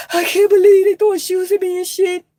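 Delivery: high whiny mock complaint; mock whiny crying. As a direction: whine it like a crybaby. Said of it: "He ain't go crying and shit and be like".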